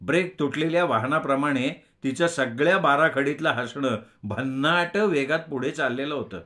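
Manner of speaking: ordinary delivery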